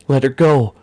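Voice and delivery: high, loud shout that cracks; high, loud strained demand; cracking yell. Acted. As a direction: yell at the captor, voice breaking. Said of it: "Silas yelled, his voice cracking".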